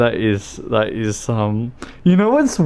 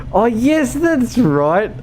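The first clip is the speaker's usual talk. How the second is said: high, squeaky, about to laugh; amused recollection; 12 semitones higher, 4 dB louder, slower than usual. Real, not acted. Streamer remembers the relay joke, high and near laughter. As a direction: rise into helpless amusement.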